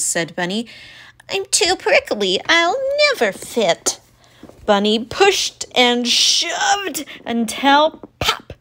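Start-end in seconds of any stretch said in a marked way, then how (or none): 1.3-4.0 high, fretful porcupine; worried squeaky critter. Acted.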